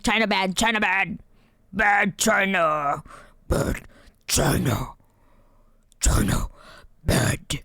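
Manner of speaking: slow, growling orc chant; Uruk-hai voice. Acted. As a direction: grunt it out like a brutish orc. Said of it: "my Urukai voice".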